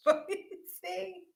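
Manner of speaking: high, squeaky words squeezed through real laughter; helpless giggles breaking up the apology; laughing. Real, not acted. Apologising to viewers for laughing at a chat message, laughing. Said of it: "I'm laughing at Bobby's".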